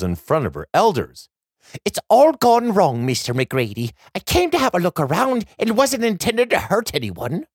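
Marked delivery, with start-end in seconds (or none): 1.8-7.5 put-on higher, worried girl voice; anxious young girl explaining. A narrator's reading. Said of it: "Aisling offers a curtsy".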